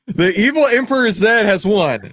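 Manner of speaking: big, loud, high winner call; hyped-up announcement. Acted. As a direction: announce it like a game show host. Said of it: "You guys like when I do that".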